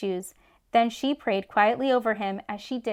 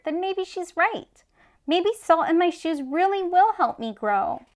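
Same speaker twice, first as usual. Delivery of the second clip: hopeful; 7 semitones higher than usual. A narrator's reading. Michael realizing with hope.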